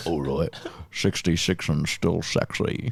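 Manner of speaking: low, slow action-star impression; Jason Statham. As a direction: drop it deep and take it slowly. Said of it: "The perfect Jason Statham?".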